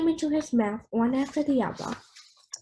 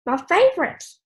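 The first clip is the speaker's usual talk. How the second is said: excited; 6 semitones higher, 5 dB louder than usual. Acted. Grandpa delighted.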